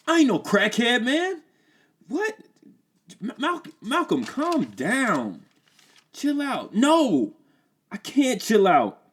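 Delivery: high, loud distraught voice; defensive then guilty; upset Malcolm. Acted.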